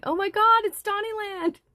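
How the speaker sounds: high, loud mock shout of a fan; imitated yelling. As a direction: squeal it out like an excited fool in the crowd. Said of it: "Some fool kept yelling".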